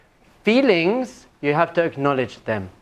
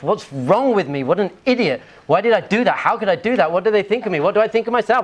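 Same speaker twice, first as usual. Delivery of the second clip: self-berating; 6 semitones higher than usual. Acted. Acted self-critical thoughts after a mistake.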